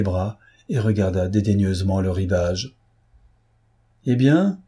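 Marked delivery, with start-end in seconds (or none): 4.1-4.6 higher, louder call across the water. A narrator's reading. Platon calls to Pierre stranded on the lake, higher and louder. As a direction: hail him from the shore. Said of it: "lui cria Platon".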